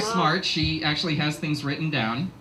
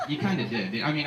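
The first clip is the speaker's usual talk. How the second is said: ordinary talk, nothing marked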